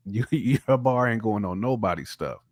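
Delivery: usual speaking voice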